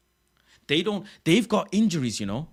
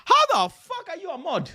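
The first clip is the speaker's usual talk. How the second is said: much higher, indignant; outraged disbelief; 12 semitones higher than usual. Real, not acted. Streamer confronting his Spurs-fan mod, mock outraged. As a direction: demand an answer in mock outrage.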